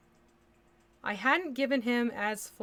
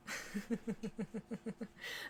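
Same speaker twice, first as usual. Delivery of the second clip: long stuttering, soft laugh; a run of quick soft chuckles; giggle; 8 dB quieter than usual. Real, not acted. Laughs at who jumped in.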